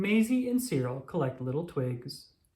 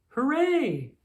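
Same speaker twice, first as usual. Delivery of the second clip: high, loud little cheer; excited cheer; 10 semitones higher, 5 dB louder than usual. Acted. Maisie cheering as she packs her bag, excited. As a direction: cheer like a kid ready to go.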